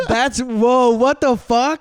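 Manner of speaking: high, loud laughing whoa; shocked laughing outburst. Real, not acted. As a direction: blurt out in startled laughter. Reacting to licking the mic.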